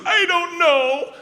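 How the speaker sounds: put-on high, drawn-out little-kid wail; panicked little boy. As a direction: wail it slowly like a panicked child. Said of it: "he's still panicked".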